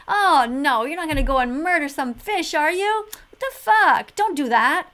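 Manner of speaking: loud, playful mock scolding; lighthearted. To a dad going fishing, a loud joking scold. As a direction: tease a neighbour about fishing, loud and joking. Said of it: "I said it in a kind of a lighthearted way".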